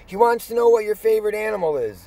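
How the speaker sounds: high, loud helpful staff voice; staff chiming in. Retold or imitated. Staff helpfully relaying his question, high and loud. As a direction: jump in to help, eager.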